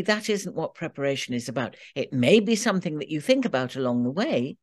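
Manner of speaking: ordinary talk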